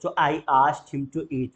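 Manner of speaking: each word said slowly and clearly; slow teacher demo; slow model sentence. Shown as a demonstration. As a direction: spell it out slowly for learners. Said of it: "मैंने कहा खाना खाने को".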